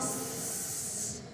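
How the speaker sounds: sss hissed thin at the teeth instead of shh; a sharp narrow sss; wrong sin sound. Shown as a demonstration. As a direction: sss like the letter sin, not shin. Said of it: "berarti itu bukan huruf shin".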